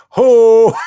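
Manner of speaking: high, held holler; mock impression shout. Host reprising his voice bit for the chat, high shout. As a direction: belt it out high and loud.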